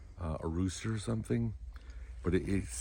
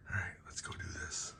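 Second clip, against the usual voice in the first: whispering; 13 dB quieter, faster than usual. Real, not acted. House asleep, quiet departure.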